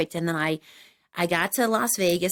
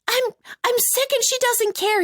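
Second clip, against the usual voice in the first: whiny complaint. Retold or imitated. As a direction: whine it like a sick child. Complaining to a friend.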